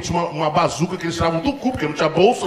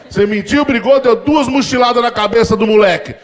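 listing accusations; 5 semitones higher, 5 dB louder than usual. Retold or imitated. Father listing offenses.

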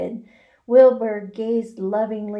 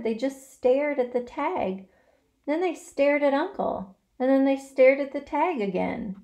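ordinary delivery

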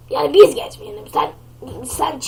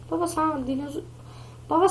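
usual speaking voice

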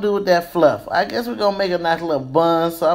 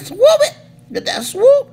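excited swoop; 4 dB louder than usual. Real, not acted. Teaching the swoop step.

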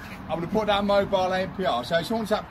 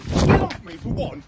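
angry; 9 semitones higher, much slower than usual. Real, not acted. Bailiff shoved at doorstep.

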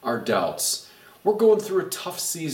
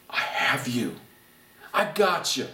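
reassuring. Pretend. God's reassurance voiced.

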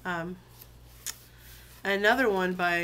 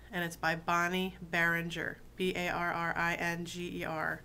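ordinary talk

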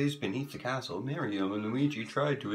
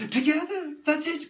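high loud realization; excited cry; 7 dB louder than usual. A narrator's reading. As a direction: cry it out in triumph. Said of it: "cried Mario".

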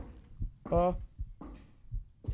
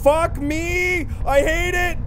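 high, loud wail of protest; real rattled, high-pitched outburst; distressed; 6 semitones higher, 5 dB louder, much slower than usual. Real, not acted. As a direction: wail how much you hate it. Frightened, hates the room.

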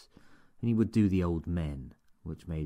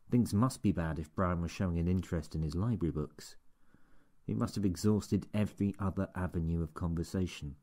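plain reading voice

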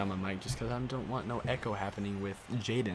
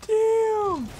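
shout; 11 dB louder than usual. Real, not acted. Frustrated at a missed play.

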